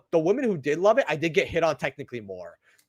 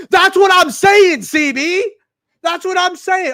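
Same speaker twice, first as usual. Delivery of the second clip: loud, high-pitched exclamation; emphatic agreement; 12 semitones higher, 9 dB louder, slower than usual. Real, not acted. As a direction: shout your agreement, voice jumping up. Emphatic agreement with a chat comment.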